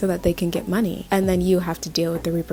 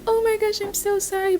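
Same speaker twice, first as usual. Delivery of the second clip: much higher mock-sweet apology; fake-sorry parent; 12 semitones higher than usual. Mimicking a parent who pretends the baby hit the wall, high mock sorry. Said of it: "they're like".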